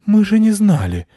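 high, loud flustered squeak; bewildered bat leader, high squeak. A narrator's reading. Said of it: "растерянно сказал главмышь".

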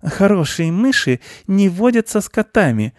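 high, prim aunt voice; moralizing aunt mouse. A narrator's reading. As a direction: declare it in a high, righteous voice. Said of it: "заявила мыши тётя".

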